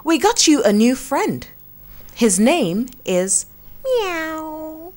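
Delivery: farmer's wife introducing a kitten to the animals, high and sweet. Acted. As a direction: present the kitten gently and sweetly.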